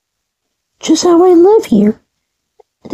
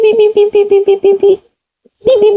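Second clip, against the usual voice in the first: rapid string of beeps, pitch easing down; imitated beeping; 3 semitones higher, faster than usual. Between play lines, imitated machine beeping. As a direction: beep steadily like a machine.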